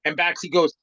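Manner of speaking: ordinary talk, nothing marked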